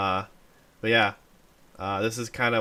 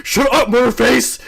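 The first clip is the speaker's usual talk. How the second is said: loud laughing shout; mock yell; 13 semitones higher, 10 dB louder than usual. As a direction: bark it at him, half laughing. Quoting Murderface cartoon line.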